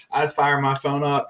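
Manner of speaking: ordinary delivery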